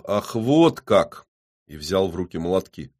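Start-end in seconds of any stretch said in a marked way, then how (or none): none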